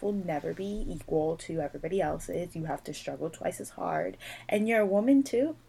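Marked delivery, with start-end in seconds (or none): 4.5-5.5 high, laughing disbelief; and a woman too, laughing in disbelief; incredulous laugh. Real, not acted. Exasperated rant about being woman.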